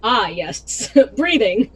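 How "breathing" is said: laughing through the word breathing. Real, not acted. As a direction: repeat it while laughing.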